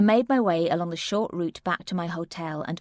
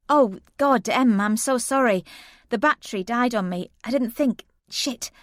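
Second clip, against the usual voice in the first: higher, louder rushed sorry; flustered apology; 5 semitones higher, 4 dB louder than usual. Acted. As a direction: gush the apology, caught out.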